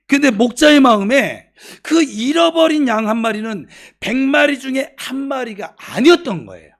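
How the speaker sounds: loud, high, insistent sermon delivery; impassioned preaching. Real, not acted. Preaches at full force. (높고 힘찬 목소리로 강하게 설교)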